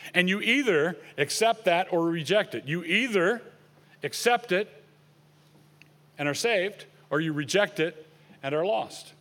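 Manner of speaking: ordinary delivery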